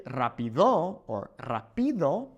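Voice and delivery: rápido said twice, stressed on later syllables; rápido as rapiDÓ, then raPÍdo; misplaced stress. Shown as a demonstration. Teacher shows wrong stresses, rápido hit on DO, then PI. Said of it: "It wouldn't be".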